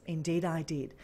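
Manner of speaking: low, formal phone reply; radio voice. Retold or imitated. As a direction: confirm it low and official, as on radio. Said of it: "Put on my best radio voice ever".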